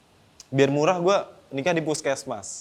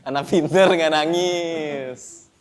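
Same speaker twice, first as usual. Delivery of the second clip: doting father; much slower than usual. Acted. Father praising child, slowed.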